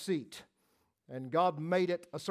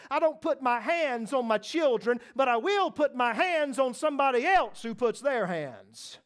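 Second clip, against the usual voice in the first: higher, louder fierce warning; protective shout; 5 semitones higher, 4 dB louder than usual. Acted. Father warning anyone who harms his children, loud. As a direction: threaten anyone who touches the kids.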